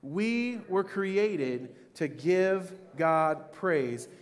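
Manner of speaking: very slow, spaced-out recitation; reciting together. Shown as a demonstration. Pastor leads congregation saying it together, very slow recitation. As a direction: stretch it word by word for others to join. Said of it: "let's say that together".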